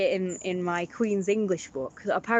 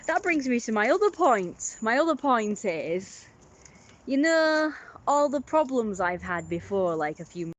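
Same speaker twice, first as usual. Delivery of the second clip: ordinary talk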